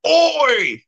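very high, sharp Kiwi Oi; Kiwi shout oi. Retold or imitated. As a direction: call out like a Kiwi. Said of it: "they'll go".

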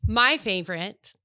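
ordinary talk

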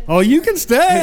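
playful ladies. Retold or imitated. Ladies voiced inviting him in.

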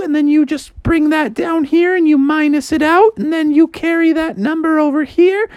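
high-pitched mom voice; walk through the steps in a high voice; high, busy mock-motherly tutoring; Froggy's explaining mom.

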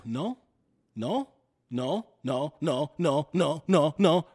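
building from firm no to a loud burst; eruption of no. Retold or imitated. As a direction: let the no's build until they boil over. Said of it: "I just kind of erupted a little bit".

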